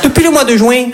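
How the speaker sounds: high-pitched Midi accent, playing romantic comedy; southern French accent. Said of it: "une comédie romantique à New York avec l'accent du Midi". (accent du Midi, voix haut perchée)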